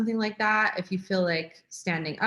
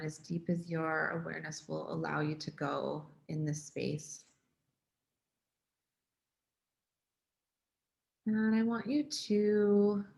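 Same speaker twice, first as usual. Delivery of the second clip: guided meditation; 2 semitones lower, 5 dB quieter than usual. Real, not acted. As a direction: ease them gently into the breath, unhurried, softening.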